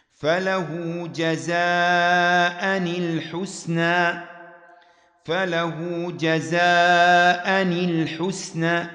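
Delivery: slow recitation with جزاء الحسنى linked as jazaa nil-husna; kasra linking. Shown as a demonstration. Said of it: "جزاء الحسنى تكسر لالتقاء الساكنين". (اقرأ جزاء الحسنى بكسر التنوين: جزاءً نِلحسنى)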